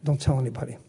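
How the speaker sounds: usual speaking voice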